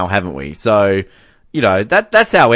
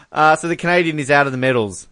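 ordinary talk

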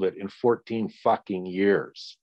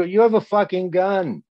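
heated retort, higher and louder; angry retort; 8 semitones higher, 5 dB louder than usual. Retold or imitated. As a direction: throw it back at the cop. Retorting to cop about danger.

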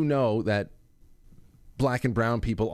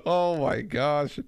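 slow amazed sigh; 5 semitones higher, much slower than usual. Real, not acted. Host reacting to story.

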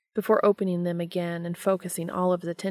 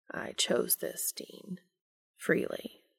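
whispered, low and slow; hushed whisper; 4 semitones lower, 7 dB quieter, slower than usual. A narrator's reading. Castiel softly insisting to Dean he chose this, whispered. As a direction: breathe it out, barely voiced.

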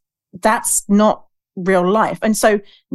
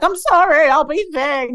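imitating crying apology; 8 semitones higher than usual. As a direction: pretend to beg through tears, pitched high. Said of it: "I'd probably be crying, being like".

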